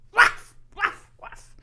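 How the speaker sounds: loud dog bark. Pretend. Barking dog in monologue.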